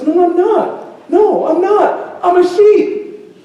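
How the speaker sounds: put-on high, drawn-out childlike cub voice; squeaky-high, slow protest; protesting little cub. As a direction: insist like a stubborn little creature. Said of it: "the little cub says".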